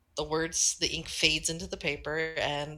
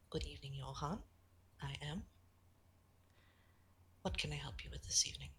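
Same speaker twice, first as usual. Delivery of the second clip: Eve's answer, much softer, whispered at the end; softly whispered reply; 13 dB quieter, faster than usual. Acted. Eve writing back to Johann, softly whispered. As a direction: murmur it as an unseen kind writer.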